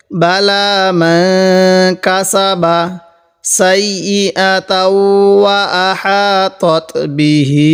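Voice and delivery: slow, drawn-out reading of the verse; slow recitation. Shown as a demonstration. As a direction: stretch each syllable like recitation. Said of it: "তাহলে". (ধীরে টেনে পড়া)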